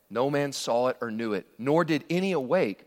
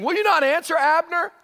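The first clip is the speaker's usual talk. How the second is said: raised, high call across the valley; 13 semitones higher, 6 dB louder than usual. Acted. David on the hilltop calling to Abner, loud and high. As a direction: shout it over the distance.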